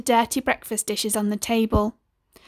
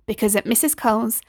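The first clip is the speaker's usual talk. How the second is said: usual speaking voice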